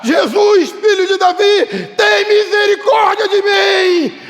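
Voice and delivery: very high, loud, drawn-out cry; full-voiced scream for mercy; shouted plea. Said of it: "Eu continuo gritando".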